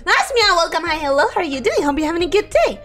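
ordinary talk

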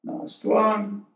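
soft, unhurried breathwork guidance; hushed yoga teacher voice during practice; guided meditation. Real, not acted. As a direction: talk them softly through each breath, leaving long pauses.

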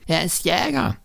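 put-on high little-boy voice; child Lennart. A narrator's reading. Boy vouching for stepfather to train driver, high child voice. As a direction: chip in as a small child.

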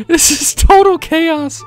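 drawn-out high wail of despair; mock-despairing high voice; high, slow squeal. Real, not acted. Overwhelmed by pets.

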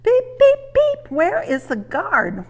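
very high, loud beeping whistle call; whistle-like beeps. A narrator's reading. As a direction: beep like a train whistle, then ask slowly. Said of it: "whistled Thomas".

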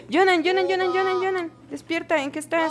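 high, repeated name calls; urgent calling out. Real, not acted. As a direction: call out insistently to wake them up. Calling a game character to wake up. (llamando con urgencia, voz aguda)

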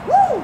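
very high loud whoop; joyful whoop. Real, not acted. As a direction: cheer it out. Cheer at the found arrow.

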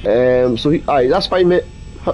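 ordinary talk

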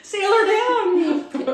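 high, slow, laughing mock alarm; laughing pirate call. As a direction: cry it out like a playful pirate.

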